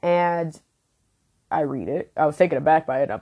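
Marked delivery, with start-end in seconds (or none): none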